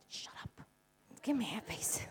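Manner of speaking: whispered, voiceless shush; hissed whisper. Retold or imitated. Mother whispering to her son during a talk, hushing him. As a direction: whisper sharply so no one hears. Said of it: "I went".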